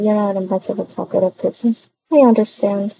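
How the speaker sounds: ordinary talk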